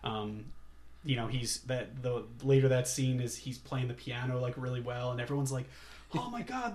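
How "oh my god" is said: high, soft mock wonder; mock amazement. Everyone hearing him play piano well, mock amazed. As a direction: imitate an awestruck listener, pitched right up.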